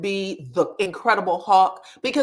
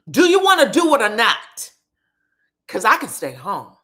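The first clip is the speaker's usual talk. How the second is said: mock yell; 6 dB louder than usual. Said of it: "He kept yelling at her like that".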